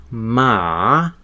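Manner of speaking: MA dipping, then sweeping upward; rising tone. Shown as a demonstration. Learner recalling Chinese tones, rising tone on MA. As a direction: draw MA out and lift the pitch to the end. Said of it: "you have the rising tone".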